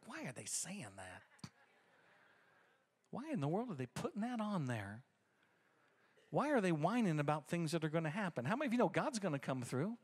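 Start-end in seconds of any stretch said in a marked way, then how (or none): 0.1-8.5 much softer, swooping baffled questions; muttered disbelief. Retold or imitated. Pastor reading church members' posts, quietly exasperated. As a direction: grumble to yourself in hushed bewilderment.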